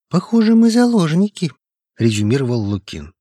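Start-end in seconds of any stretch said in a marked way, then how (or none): 0.1-1.5 higher, louder, alarmed voice; anxious colleague. A narrator's reading. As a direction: sound the alarm, high and tense. Lukin's voice, alarmed conclusion. (встревоженный голос, выше и громче)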